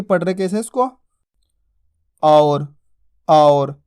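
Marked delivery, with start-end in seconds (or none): 2.2-3.8 और twice, low and held, with au glide; lower, steady model of और with open au; correct pronunciation. Shown as a demonstration. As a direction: say और slowly as au-r, pausing between. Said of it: "इस तरीके से इसका उच्चारण किया जाता है".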